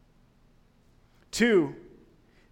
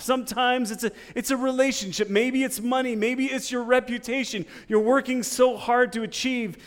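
higher and louder, driving and urgent; preaching at full force; 5 semitones higher, 5 dB louder than usual. Acted. Preacher urging the congregation toward God, full force. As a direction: build and hammer each line home.

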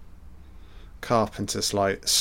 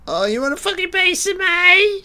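high squeaky mock voice, fast; 4 dB louder than usual. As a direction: squeak out a sarcastic taunt at the character. Mocking a film character.

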